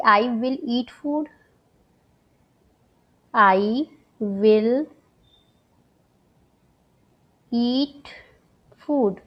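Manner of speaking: "I will eat food" very slowly with long pauses; slow example. Shown as a demonstration. Teacher to students, giving the future example very slowly. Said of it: "वहीं अगर मैं बोलूँ".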